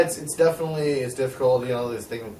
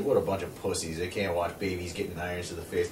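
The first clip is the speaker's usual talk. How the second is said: quiet mocking scoff; 10 semitones lower, 13 dB quieter than usual. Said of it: "people out there ... just like".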